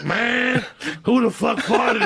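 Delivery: high, drawn-out mock indignation; hammed-up outraged whine; imitated outraged complaint. Retelling disgusted bus rider's outburst.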